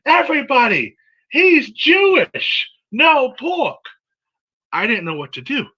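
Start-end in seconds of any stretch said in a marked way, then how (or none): 0.1-3.8 high, loud, drawn-out announcement yell; acted-out yell. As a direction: yell it down a line of food stalls. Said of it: "turned down the line of tables and yelled".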